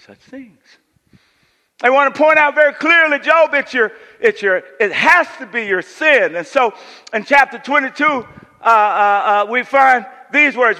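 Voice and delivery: loud, high, driving pulpit voice; full-force preaching. Acted. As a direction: preach it hard to the whole room. Preaching Job's friends' accusations.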